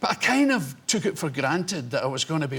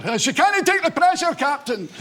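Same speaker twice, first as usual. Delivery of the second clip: high, loud, panicky Scottish engineer; hammed-up Scottish ship's engineer; movie Scottish engineer; 10 semitones higher, 4 dB louder than usual. Film engineer warning his captain, panicky Scottish engineer. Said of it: "that Scottish engineer".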